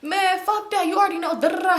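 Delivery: mock his indignant dismissal; retold trash talk. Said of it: "he talking shit".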